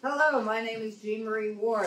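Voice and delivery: usual speaking voice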